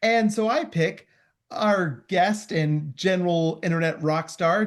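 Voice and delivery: ordinary delivery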